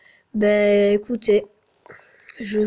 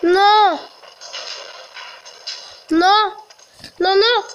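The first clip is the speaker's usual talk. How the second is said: panicked; 9 semitones higher than usual. Real, not acted. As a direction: cry out trapped and terrified. Trapped, panicking.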